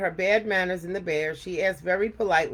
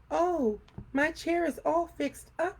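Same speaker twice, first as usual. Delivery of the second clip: much higher, slow delighted cub; happy Baby Bear; 11 semitones higher, slower than usual. A narrator's reading. Baby Bear delighted at his mended chair, high glad voice. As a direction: squeal with joy like a baby bear. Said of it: "laughed Baby Bear".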